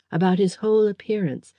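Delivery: ordinary delivery, nothing marked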